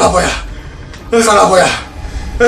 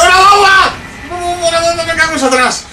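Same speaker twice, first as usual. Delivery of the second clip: excited; 9 semitones higher than usual. Real, not acted. Grabbing a bomb.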